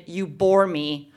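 slow, low, bored put-down; bored boyfriend. Acted. Boyfriend to her after yawning, bored and slow. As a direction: drag it out low, as after a yawn.

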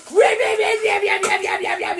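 put-on gobble like a greedy baby troll; giant baby troll. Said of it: "And he goes".

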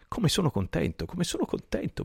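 high, eager repeated joy; excited. A narrator's reading. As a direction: gush with delight at the reunion. Overjoyed reunion with old friend.

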